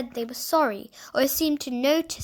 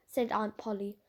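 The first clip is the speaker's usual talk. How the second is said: plain reading voice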